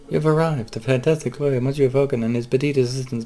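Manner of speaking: light up at the famous lawyer's arrival; excited greeting. Acted. Cat lady eagerly greets her lawyer with a high excited voice.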